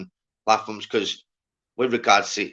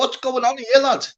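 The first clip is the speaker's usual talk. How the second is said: incredulous; 12 semitones higher than usual. Retold or imitated. Retelling reaction to the blame.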